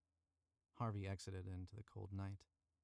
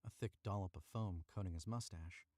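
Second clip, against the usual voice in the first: usual speaking voice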